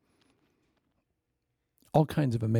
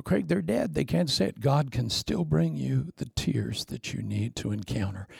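ordinary talk, nothing marked

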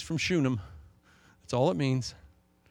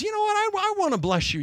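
a much higher, light prophet voice; prophet's promise. Acted. Prophet announcing a blessing to the old woman, high and bright. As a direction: lift way up into the kindly prophet.

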